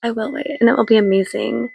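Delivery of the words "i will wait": soft resigned repeat. Real, not acted. Repeated, much softer.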